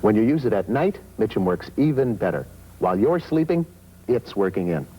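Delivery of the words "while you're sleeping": normal talking voice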